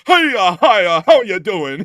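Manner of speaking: high, very loud, drawn-out cartoon greeting; mock cartoon American. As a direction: blare it like an over-the-top imitation American. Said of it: "how the Japanese imitate Americans".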